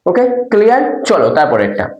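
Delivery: ordinary delivery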